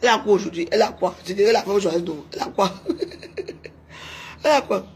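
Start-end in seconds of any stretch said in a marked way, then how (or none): 2.8-3.7 soft, high-pitched laughter; high giggle. Real, not acted. Streamer mocking what rival has, high giggle. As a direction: giggle high after the taunt.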